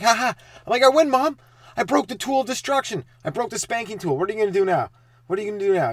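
put-on high, loud childish gloat; gloating taunt.

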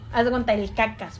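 ordinary talk, nothing marked

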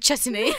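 chutney as chut-uh-nee, through a laugh; odd pronunciation. Retold or imitated. Echoing friend's sitcom impression, mispronouncing chutney. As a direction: laughingly add an extra vowel to chutney. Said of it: "he calls chutney chutney".

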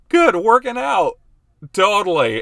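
high, loud mock agreement; hammed-up, over-eager yes; fake enthusiasm. Husband pretending he meant working out, loud fake enthusiasm.

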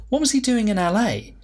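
surprised thought. Acted. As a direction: pitch jumps up in disbelief. Inner suspicious question.

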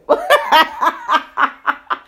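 loud, high burst of laughter; long cackling laugh; hearty laugh. Real, not acted. Laughs after answering the doubt.

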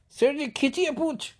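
high, loud pleading shout; urgent call. Retold or imitated. Servant urging the master to pull the tail, high loud shout. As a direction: urge him on while straining.

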